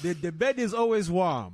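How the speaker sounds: loud, high, hammed-up Nigerian accent. Joking about dad's many children, loud Nigerian accent. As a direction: belt it out in a playful Nigerian accent. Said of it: "that is a Nigerian accent".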